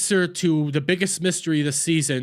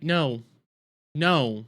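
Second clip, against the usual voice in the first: ordinary talk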